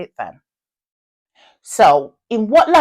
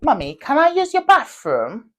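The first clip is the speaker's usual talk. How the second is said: put-on child asking mommy; 6 semitones higher than usual. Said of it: "and then you go".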